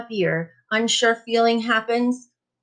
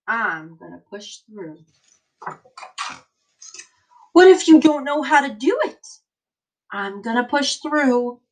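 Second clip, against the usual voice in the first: put-on slow, emphatic read-aloud for kids; reading to children; 2 semitones lower, 3 dB louder than usual. Teacher reading an encouraging book to her class, emphatic. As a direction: read it like a rousing picture book.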